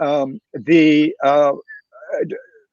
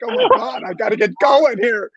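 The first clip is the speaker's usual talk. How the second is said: a sudden burst of pumped-up energy, much higher; fired-up excitement; 8 semitones higher than usual. Retold or imitated. Speaker hyped by the panel, excited and much higher. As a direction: jolt yourself awake like you have to get moving now. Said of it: "I'm like".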